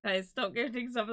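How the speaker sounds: normal talking voice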